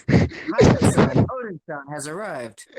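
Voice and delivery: booming, slow herald call; slow loud announcement. Acted. Reading a manga announcement.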